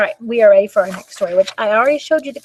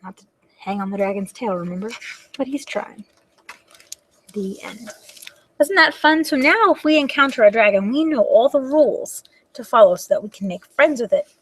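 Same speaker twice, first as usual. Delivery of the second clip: ordinary delivery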